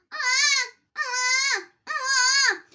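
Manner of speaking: very high, loud nasal ah; twang ah. Shown as a demonstration. Teacher finishing the twang drill on a high ah. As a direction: ring the ah bright and high. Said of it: "Se practica de la siguiente forma".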